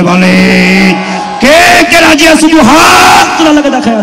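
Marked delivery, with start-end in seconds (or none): 1.4-4.0 high, loud shout to the crowd; loud high rallying call. Acted. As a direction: demand hands up from the crowd. Calling crowd to raise hands.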